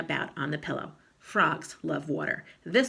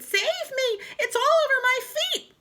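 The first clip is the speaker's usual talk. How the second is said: high, loud terrified shriek; wife shrieking as the frog crawls on her feet; panicked scream; 7 dB louder than usual. A narrator's reading. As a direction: scream for rescue.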